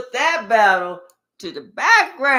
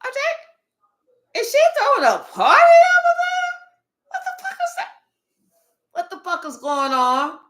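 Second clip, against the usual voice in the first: much higher, shocked squeal; nosy neighbour gasping; 6 dB louder than usual. Retold or imitated. Ex peeking over the fence at the party, shocked high squeal. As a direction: peer over the fence in disbelief. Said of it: "they see you like".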